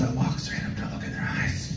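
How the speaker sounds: hushed, voiceless set-up; whisper. Real, not acted. Comic setting up the smooth move, whispering. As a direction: whisper it like a secret. Building the seduction moment.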